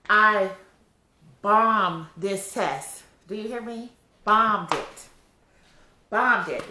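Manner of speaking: loud, exasperated venting about the test; frustrated outburst. Real, not acted. Pregnant vlogger venting to viewers about failing a test, exasperated and loud. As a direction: let it burst out, fed up.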